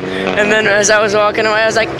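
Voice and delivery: ordinary delivery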